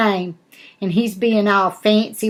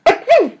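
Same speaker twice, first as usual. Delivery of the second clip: high, sharp mock "Achoo!"; fake sneeze; 4 dB louder than usual. Shown as a demonstration. Nana demonstrating the polite sneeze for the girls, high mock sneeze. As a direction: sneeze out a big playful achoo. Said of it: "you go".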